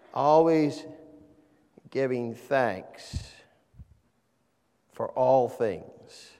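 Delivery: very slow, with long pauses after "Always" and "thanks"; phrase-by-phrase emphasis. Shown as a demonstration. Preacher rereading the passage for emphasis, slow and spaced. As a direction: let each phrase of the verse sink in separately. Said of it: "you emphasize certain words there".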